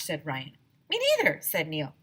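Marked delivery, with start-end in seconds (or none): 0.9-1.4 put-on very high loud little kid voice; a small boy piping up; high child voice; squeaky kid.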